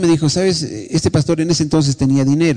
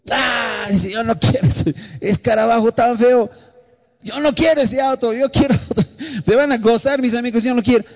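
put-on higher, sulky teen whine; sulky daughter; 6 semitones higher than usual. Daughter sulkily refuses the ugly old car, higher whine. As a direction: whine like a spoiled girl refusing.

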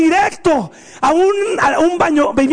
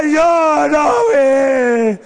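crying denial; much slower than usual. Retold or imitated. Boy dragged to the bedroom wails he didn't do it. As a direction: sob and yell your innocence while being dragged. Being spanked, wailing.

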